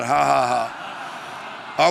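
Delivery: low practice ha-ha-ha; deliberate spoken laugh, low in pitch; fake laugh. Shown as a demonstration. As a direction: model a put-on laugh for them. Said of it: "just practice that".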